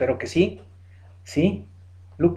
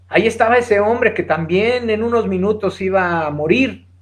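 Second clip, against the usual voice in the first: ordinary talk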